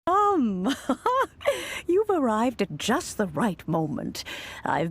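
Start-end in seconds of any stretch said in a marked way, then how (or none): 0.7-1.8 a high, gentle grandmotherly titter. Acted. Old granny chuckling as her visitor arrives.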